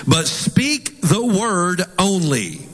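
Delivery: each word drawn out slowly; slow emphasis. Shown as a demonstration. Preacher reading King James verse to congregation, slow emphasis.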